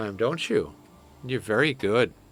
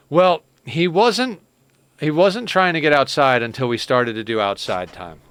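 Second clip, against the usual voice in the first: louder, stressed protest; loud emphatic; 4 semitones higher, 7 dB louder than usual. Real, not acted. Owner loudly defending her cat's habits, emphatic. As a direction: insist loudly that he never did.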